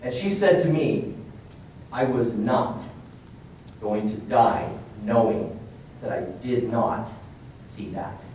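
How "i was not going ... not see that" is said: low, slow, measured quote; retold grave declaration with long pauses; solemn resolve. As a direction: spell it out slowly, sinking to near-quiet.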